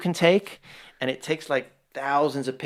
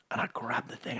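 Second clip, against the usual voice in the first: breathy whisper; 3 semitones lower, 15 dB quieter than usual. Real, not acted. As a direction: whisper it to yourself. Quiet aside while narrating.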